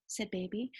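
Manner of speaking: ordinary delivery, nothing marked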